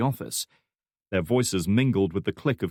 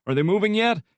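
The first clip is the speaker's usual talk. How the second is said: much higher, loud call across the room; 12 semitones higher, 5 dB louder than usual. A narrator's reading. Section chief calling across the noisy room, loud. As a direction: call out over the busy office. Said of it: "he called out".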